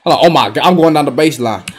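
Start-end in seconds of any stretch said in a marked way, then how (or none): none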